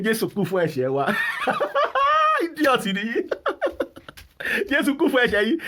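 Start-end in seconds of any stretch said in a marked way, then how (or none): 1.1-2.4 long, very high peal of laughter; derisive laugh. Real, not acted. Ranting at pastors and followers, high derisive laughter. 2.4-5.6 high-pitched, laughing through the insult; scornful laughing. Real, not acted. Ranter deriding church followers over Jesus's death, high scornful laughing.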